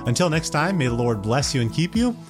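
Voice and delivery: ordinary delivery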